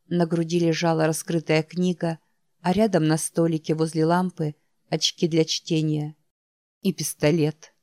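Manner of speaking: ordinary delivery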